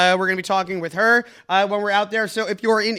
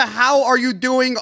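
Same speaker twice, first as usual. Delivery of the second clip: normal talking voice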